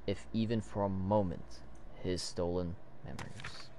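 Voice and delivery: hushed, lowering narrator's read; dramatic story narration. Acted. Reads comic narration aloud.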